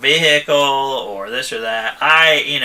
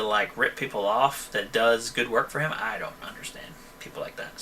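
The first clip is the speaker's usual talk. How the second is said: whisper; 4 semitones lower, 11 dB quieter than usual. Real, not acted. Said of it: "speaker drops to whisper".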